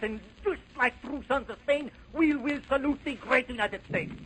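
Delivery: ordinary talk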